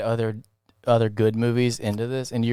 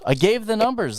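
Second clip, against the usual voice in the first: emphatic repeat; 11 semitones higher than usual. Real, not acted. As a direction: hammer the point home the second time. Repeats his point more forcefully.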